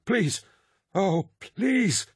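very high, panicked pleading; panicked gabbling. A narrator's reading. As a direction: beg in terror, voice leaping high. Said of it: "Sinderman gabbled".

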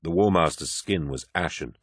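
plain reading voice

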